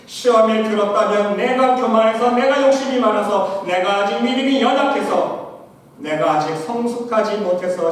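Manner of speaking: loud, high ringing preacher's cry; full-force preaching. Acted. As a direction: lift the congregation toward heaven. Listing causes, preaching loud.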